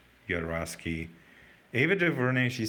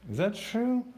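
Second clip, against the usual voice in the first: shocked disbelief; 12 semitones higher than usual. Real, not acted. Shocked by death rumour.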